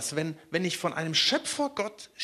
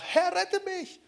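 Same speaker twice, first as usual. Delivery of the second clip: much higher, desperate plea; panicked cry; 14 semitones higher, slower than usual. A narrator's reading. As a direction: cry out as he sinks. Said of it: "schrie er und sprach".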